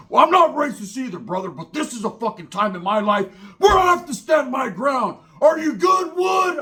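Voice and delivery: high, loud, fired-up biker rant; imitated hothead shouting his case; retold screaming. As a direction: build to a full yell at the end. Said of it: "the whole time he's fucking screaming".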